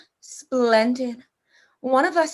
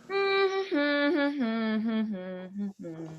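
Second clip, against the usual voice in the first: soft chuckle; 3 semitones lower, 8 dB quieter than usual. Acted. General laughs at his guest.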